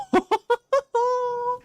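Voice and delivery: high, squealing laugh at something adorable; real delighted giggle. Real, not acted. Delighted by cute character.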